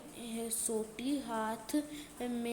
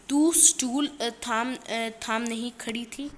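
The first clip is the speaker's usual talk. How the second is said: scolding sister; 8 dB louder, slower than usual. Acted. Keshav scolds Shyama about the stool.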